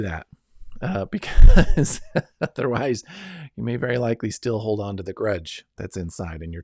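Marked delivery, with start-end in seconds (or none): 0.8-3.0 laughing through the words; real laugh bursting into the sentence. Real, not acted. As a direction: break up laughing, much louder. Breaks into laughter while explaining.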